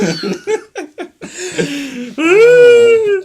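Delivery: big squealing laugh; high cackling laugh. Real, not acted. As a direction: crack up high. Bursts out laughing.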